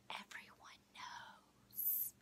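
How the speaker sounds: slow, barely audible whisper. Retold or imitated. Quoting his reply to Morgan, in a slow whisper.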